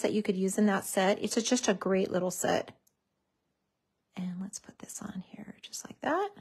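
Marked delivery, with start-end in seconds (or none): 4.2-6.3 whispered. Real, not acted. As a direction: whisper while concentrating. Placing sentiment carefully.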